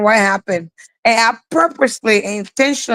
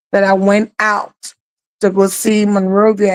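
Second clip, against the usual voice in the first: normal talking voice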